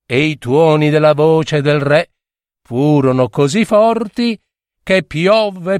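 ordinary delivery, nothing marked

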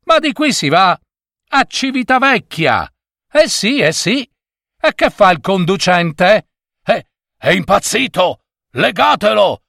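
high, indignant passengers' outcry; stormy protest. A narrator's reading. Passengers protest at the driver leaving the route, stormy indignation. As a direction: protest as an outraged crowd would. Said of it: "le proteste diventarono un coro tempestoso".

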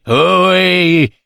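drawn-out call in a put-on voice; mock holler of an old man in disguise; disguised voice calling out. A narrator's reading. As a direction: call out as someone else, voice changed. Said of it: "mutando la voce gridò".

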